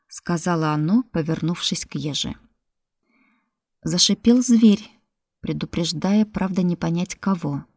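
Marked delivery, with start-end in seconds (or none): none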